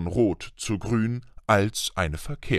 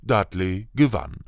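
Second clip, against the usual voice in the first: usual speaking voice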